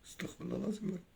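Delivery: barely audible, fading to a whisper; tiny hushed voice seeking forgiveness; murmured repentance. Real, not acted. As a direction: breathe the prayer to yourself, very softly. Repents after screaming curses.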